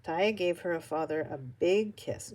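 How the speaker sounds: ordinary delivery, nothing marked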